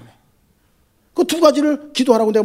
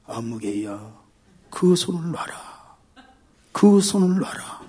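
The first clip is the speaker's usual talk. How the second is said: much lower, softer, slow booming God voice; 5 semitones lower, 6 dB quieter, much slower than usual. Retold or imitated. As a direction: speak as God from above, slow and low. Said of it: "그랬더니 그 말이". (낮고 느린 하나님 목소리)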